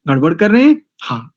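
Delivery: usual speaking voice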